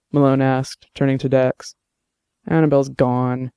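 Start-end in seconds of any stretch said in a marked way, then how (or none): none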